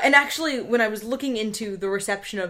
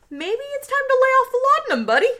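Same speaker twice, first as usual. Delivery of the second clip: high fast teasing aside; high, quick mocking advice; sarcastic quip; 4 dB louder, much faster than usual. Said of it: "I, for the third time, comment".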